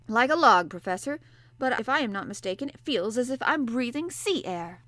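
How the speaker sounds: a sleepy sailor answering the professor; gruff harpooner. Acted. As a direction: play the bluff Canadian harpooner, hearty and loud. Ned Land voiced in audiobook.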